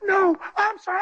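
high, tearful pleading; bawling. Real, not acted. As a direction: speak through tears, pitch way up. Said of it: "And I'm bawling".

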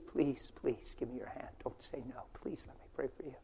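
hushed, earnest begging; soft pleading. Retold or imitated. Preacher begging a sick stranger, hushed pleading. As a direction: plead softly, never rising. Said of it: "I just pleaded".

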